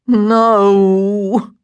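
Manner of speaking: long, drawn-out moan; stretched-out moaning protest. A narrator's reading. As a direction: groan the word in dismay. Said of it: "Daniel moaned".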